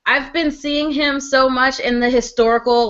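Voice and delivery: normal talking voice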